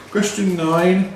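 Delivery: very slow, drawn-out question number; quiz question announcement. Acted. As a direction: stretch it out like a quizmaster.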